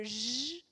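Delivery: "j" as a long voiced "zh"; isolated phoneme. Shown as a demonstration. Teacher linking sound to joue, "j" held as "zh". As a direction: hold "j" as a buzzing French sound, not the letter name. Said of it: "l'acquisition aussi de la correspondance".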